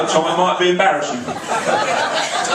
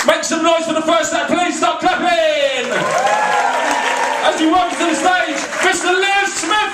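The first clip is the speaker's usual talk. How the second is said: announcer intro; 10 semitones higher than usual. Acted. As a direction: whip the crowd up for the act. Compere announces the act.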